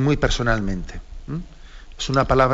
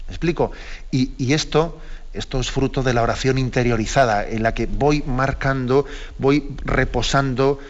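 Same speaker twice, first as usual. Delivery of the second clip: normal talking voice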